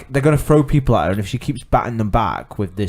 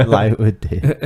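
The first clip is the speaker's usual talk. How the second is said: laughing; much slower than usual. Real, not acted. Laughing repeat of friend's line.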